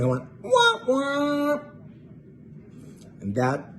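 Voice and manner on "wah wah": higher mouthed sad trombone, wah wah. Retold or imitated. Back to the band after the letdown, a sad trombone wah wah. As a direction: deflate like a losing game-show horn. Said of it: "I just did the same thing".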